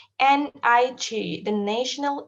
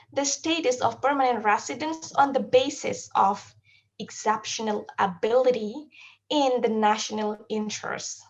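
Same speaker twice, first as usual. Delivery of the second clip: ordinary delivery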